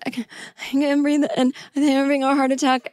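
high, panicky pleading; panicked. Retold or imitated. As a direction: gasp it out in a panic. Panicking to flight attendants.

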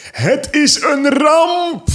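high, slow drawn-out wail; burgemeester impression. Podcaster imitates the mayor's catchphrase, high and slow. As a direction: stretch it out high like a dramatic mayor. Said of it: "man Bart van Peer".

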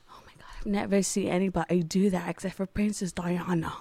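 hushed retold awe. Retelling someone's awed reaction in hospital.

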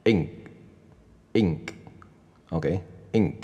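very slow "ink", pausing before the k; teacher demo of "ink" very slowly; ink with a stop. Shown as a demonstration. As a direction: say "ink" with a slight hold before the k. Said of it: "我们就顿一下".

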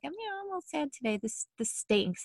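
high, soft, a touch of self-pity; mildly sad. Acted. Mild sadness voiced as example.

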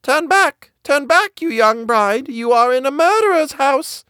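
high, ringing bird call. A narrator's reading. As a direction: sing out the warning like a caged bird. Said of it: "It cried out again".

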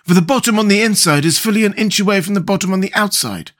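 high-pitched storybook Scarecrow; explaining Scarecrow. Acted. Scarecrow explains the false bottom to his friends, explaining Scarecrow voice.

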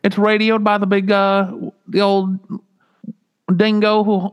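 put-on terrible Australian accent. Host teasing listeners in Australia: bad Australian accent. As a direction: drawl it like a fake Aussie. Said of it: "my terrible Australian accent".

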